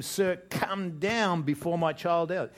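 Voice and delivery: "come down" pitched high, loud and drawn out; emphatic plea. Acted. Nobleman urging Jesus to save his child, emphatic. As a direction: lean slowly and hard on "come down". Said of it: "There's two emphatic".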